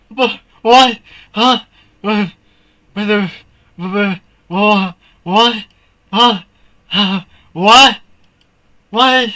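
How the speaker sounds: high loud bewildered questions; panicked confusion. Real, not acted. Gamer baffled by the game breaking. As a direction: stammer in alarm.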